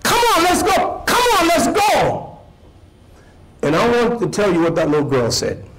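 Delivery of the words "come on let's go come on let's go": mock a man hurrying kids along; impatient man calling. Man urging a lagging girl to keep walking, high impatient call. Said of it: "And he says".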